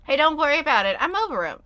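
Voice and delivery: chirpy game character reassuring. Acted. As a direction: voice the character brightly. Voices game character, louder, higher.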